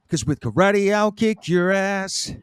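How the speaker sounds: tough guy. Pretend. Hammed-up tough-guy show intro.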